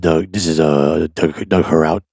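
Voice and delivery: low gravelly man's voice; Doug Heraut voice. Retold or imitated. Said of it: "it's pretty good Doug Heraut voice".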